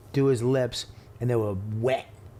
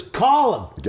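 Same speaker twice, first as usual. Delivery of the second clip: stern mother; 6 semitones higher, 4 dB louder than usual. Retold or imitated. Mother retold ordering call.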